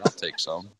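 quiet, low-key cowboy impression; cowboy at a bar. Teasing the co-host as a rider walking into a bar, cowboy voice. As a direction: mimic a Southern cowboy ordering under his breath. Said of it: "sound like you're riding a horse".

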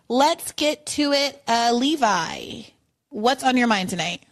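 normal talking voice

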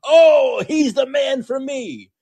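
high, squealing mock adoration; mocking fan gush. Host mocks a Biden fan, with squealing sarcasm.

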